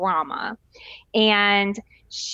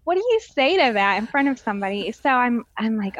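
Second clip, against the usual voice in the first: ordinary talk, nothing marked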